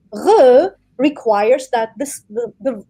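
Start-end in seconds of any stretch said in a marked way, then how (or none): 0.1-0.7 Re drawn out from deep in the throat; throaty French r. Shown as a demonstration. Explaining the throat's part, long throaty French "Re". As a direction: keep the tongue out of it, throaty "Re". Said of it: "sound comes from a deeper throat".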